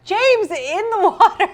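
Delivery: put-on high, loud, slow child's voice with a laugh; mimicking the son. Wife laughing as she imitates son calling out. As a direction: squeal it like a little boy. Mocking son's toilet cry.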